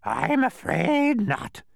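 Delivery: high, loud, slow doleful squawk; mournful bird screech; sad bird. A narrator's reading. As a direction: draw it out sadly as a screechy bird. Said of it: "he replied sadly".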